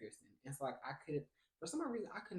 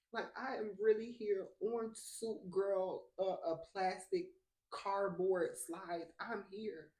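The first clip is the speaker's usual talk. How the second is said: retold crying; 5 semitones higher, 4 dB louder, slower than usual. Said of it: "I'm crying".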